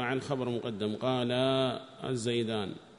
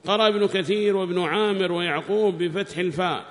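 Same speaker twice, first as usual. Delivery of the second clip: high, loud, slow recitation of a text; declaimed scholarly reading; reading aloud; 7 semitones higher, 8 dB louder than usual. Acted. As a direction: read it out loudly and deliberately, pitched up. Reads the book aloud.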